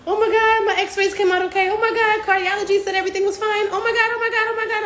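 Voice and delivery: thrilled patient. Pretend. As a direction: squeal over every good result. Patient thrilled by test results.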